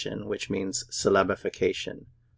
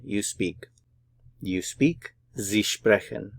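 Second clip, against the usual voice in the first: ordinary talk, nothing marked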